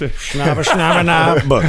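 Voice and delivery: mock Chinese gibberish, sing-song; bad made-up Chinese accent; fake Chinese accent. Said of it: "That was the worst Chinese accent".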